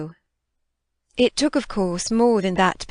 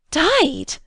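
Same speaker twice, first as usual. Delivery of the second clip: almost shriek the word in horror; high shocked cry; near shriek; 9 semitones higher than usual. A narrator's reading. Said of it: "I almost shrieked".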